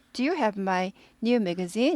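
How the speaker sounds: slow, soft question climbing on magazine; rising question. Shown as a demonstration. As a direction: lift the end of magazine slowly. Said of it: "이렇게 올라가죠". (magazine 끝을 천천히 올려 묻기)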